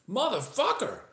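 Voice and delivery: high-pitched acted Texan outburst; startled curse. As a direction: yelp it out in sudden shock. Startled driver's outburst in the story.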